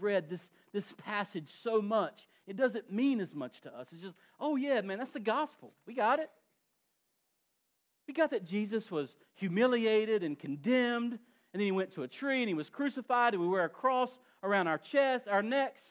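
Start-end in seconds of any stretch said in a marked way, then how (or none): 4.4-6.3 high, breezy know-it-all tone; sing-song shrug of a bored believer; mock complacent believer. Mocking complacent churchgoers. 8.1-15.8 louder, higher sermon build; preacher warming up to full force; rising preaching. Acted.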